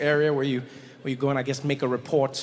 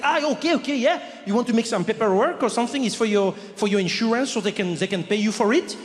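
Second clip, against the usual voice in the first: put-on higher French-accented agreeableness; cool French guy; 5 semitones higher, 3 dB louder than usual. French clerk offering insurance paperwork, French accent. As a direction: catch on eagerly in a French accent. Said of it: "but very French, very French, cool guy".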